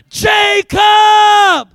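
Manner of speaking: loud, high, drawn-out name; calling out. Retold or imitated. Preacher voicing the call heard one October night, calling out. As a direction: belt the name as a summons.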